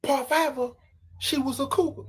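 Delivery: normal talking voice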